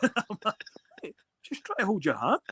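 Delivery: giggling through the line; laughing. Retold or imitated. Player laughing at the raging opponent. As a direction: laugh as you play innocent. Laughing while retelling reply.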